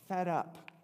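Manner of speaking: usual speaking voice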